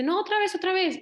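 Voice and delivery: put-on a small girl upset her bubbles keep popping; frustrated little girl. Girl failing to blow bubbles, high whiny protest. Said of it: "Y le dice".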